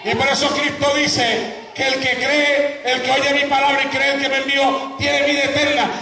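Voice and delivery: high-pitched, fervent pulpit proclamation; full-force preaching. Acted. Preacher urges congregation to accept Christ, full-force preaching. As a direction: cry the word out with long, stretched key phrases.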